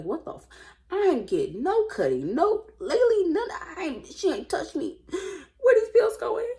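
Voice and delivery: hammed-up frustrated husband, rising high and loud; mock outraged husband. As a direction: play the husband cracking up in disbelief.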